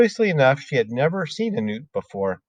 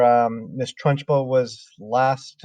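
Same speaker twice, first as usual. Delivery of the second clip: ordinary delivery, nothing marked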